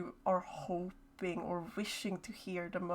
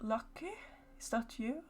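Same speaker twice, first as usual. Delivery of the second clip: faint calling voice; 3 semitones higher, 8 dB quieter than usual. Acted. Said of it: "and then it goes".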